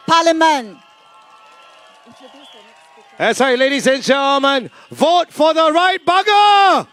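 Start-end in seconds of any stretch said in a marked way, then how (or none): 3.2-6.9 rally shout. Real, not acted. Rally speaker to crowd.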